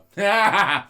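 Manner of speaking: loud burst of laughter. Real, not acted. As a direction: crack up laughing. Laughing at the joke.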